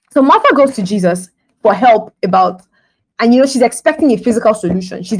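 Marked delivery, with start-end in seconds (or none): none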